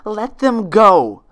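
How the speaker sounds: very loud, slow desperate shout. Acted. Twilight demanding friends' release, loud shout. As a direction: scream it at her. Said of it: "she shouted".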